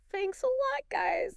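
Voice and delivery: high-pitched mock gratitude; sarcastic. Israelites blaming Moses and Aaron, sarcastic. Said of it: "you can just hear the sarcasm".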